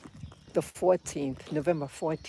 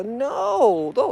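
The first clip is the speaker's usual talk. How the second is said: higher, louder bleating no; goat saying no; 6 semitones higher, 10 dB louder than usual. Retold or imitated. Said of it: "the goat go".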